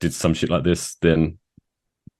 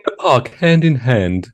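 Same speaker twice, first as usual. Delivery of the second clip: higher, slow, genteel courting voice; prim polite suitor; 5 semitones higher, 4 dB louder than usual. Pretend. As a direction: play an absurdly proper gentleman on a date. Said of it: "one wants to slide into someone's D M".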